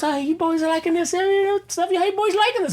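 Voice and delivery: a hammed-up creepy host asking if they enjoy it; imitating Colin Farrell. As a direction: coo at the boys like a smarmy host. Colin Farrell checks on them.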